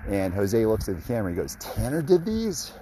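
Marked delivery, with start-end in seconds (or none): 1.6-2.7 high, slow amazed question; retold wonder. Jose asking the camera, imitated high amazement. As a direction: imitate him marveling, drawn out and high.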